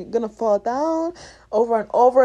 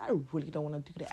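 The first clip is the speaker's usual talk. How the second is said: low, soft, rushed whiny excuse; grumbling lazy self; 10 semitones lower, 14 dB quieter, much faster than usual. Retold or imitated. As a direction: mumble it like you can't be bothered.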